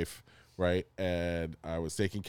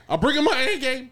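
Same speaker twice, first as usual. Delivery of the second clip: laughing; 7 dB louder than usual. Real, not acted. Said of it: "I'm sorry".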